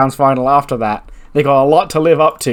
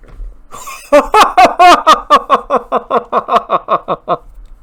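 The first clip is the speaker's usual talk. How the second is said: sudden delighted laugh; loud high laugh; 12 semitones higher, 6 dB louder than usual. Real, not acted. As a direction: burst of high-pitched laughter. Laughs at his own result.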